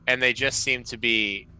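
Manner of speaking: ordinary delivery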